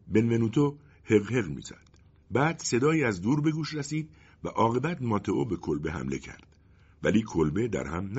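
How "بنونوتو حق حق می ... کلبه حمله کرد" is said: plain reading voice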